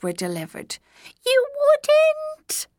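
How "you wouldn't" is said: very high, loud goblin squeak. A narrator's reading. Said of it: "squeaked the goblin".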